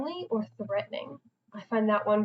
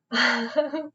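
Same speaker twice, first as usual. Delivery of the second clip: short amused laugh; real laugh; 3 semitones higher than usual. Real, not acted. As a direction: laugh briefly at your own aside. Laughs at own shout-out joke.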